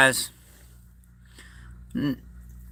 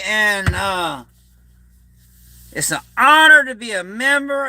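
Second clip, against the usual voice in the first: upbeat sign-off; 6 semitones higher than usual. Acted. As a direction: sign off the show with gusto. Energetic stream sign-off.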